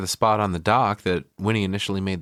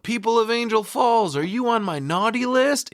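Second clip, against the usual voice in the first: raised, higher mock call to the crowd; hammed-up mayor calling out to the square; mock yell; 12 semitones higher than usual. Mayor calling out to the town square, a mock yell. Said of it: "he yells out".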